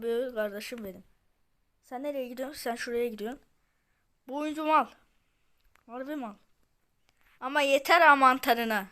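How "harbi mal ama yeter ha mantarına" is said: normal talking voice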